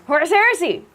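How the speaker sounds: high, loud hyped-up cheer; exclaimed chat message. Retold or imitated. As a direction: shout it as if it had several exclamation points. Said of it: "with three exclamation points".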